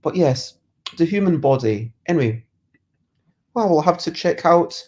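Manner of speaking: normal talking voice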